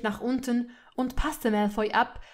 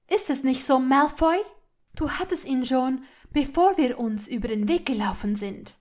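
raised voice, demanding an admission; angry accusation; 4 semitones higher, 7 dB louder than usual. A narrator's reading. Hermine pressing silent Malfoy for an answer, angry accusation. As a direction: corner him with the charge. She keeps accusing him angrily.

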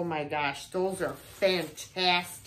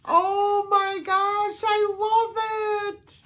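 high, slow, close to tears with joy; delighted squeal; 11 semitones higher, 5 dB louder than usual. Real, not acted. Vlogger seeing a vintage Valentine, squealing with teary delight. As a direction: gush over it, voice cracking.

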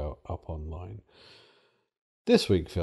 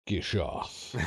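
dinosaur voice; 8 dB quieter than usual. Pretend. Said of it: "My dinosaur impression".

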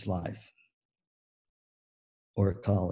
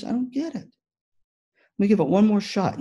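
confused Shamu; 9 semitones higher than usual. Acted. Speaker voices Shamu for teachers, high and confused. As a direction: puzzle it out, then try again.

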